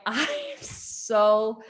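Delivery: slow, drawn-out disbelief; mock outraged reply to the boss; exasperated. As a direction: stretch it out like you're fed up.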